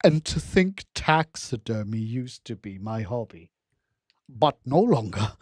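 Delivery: low, slow, lightly voiced villain line; a hint of a creepy collector, drawn out; quoting the character. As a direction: savour it slowly like the game's villain. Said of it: "And he's like".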